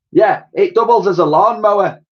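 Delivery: high, loud comic lady voice; cheeky old char lady; old cleaning lady. A narrator's reading.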